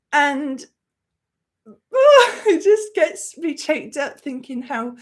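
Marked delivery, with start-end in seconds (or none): 1.9-5.0 laughing through tears; a real catch of emotion in the throat; choked up. Real, not acted. Choked up retelling the Star Wars ending, teary laugh. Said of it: "without crying".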